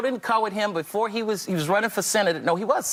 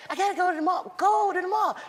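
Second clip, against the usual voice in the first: much higher Michael impression; Michael wanting to go shopping; 12 semitones higher than usual. Voices Michael.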